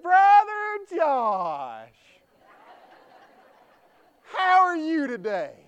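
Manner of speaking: high, slow, strained sweetness; mother covering her embarrassment; startled mom. Retold or imitated. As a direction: stretch the greeting out, caught red-handed. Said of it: "she said".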